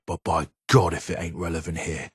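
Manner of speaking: ordinary talk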